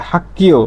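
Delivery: "hak-kio" for হাক্কিয়, no g sound; corrected k reading. Shown as a demonstration. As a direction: say হাক্কিয় with a voiceless double k. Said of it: "তাহলে এর উচ্চারণটা হবে".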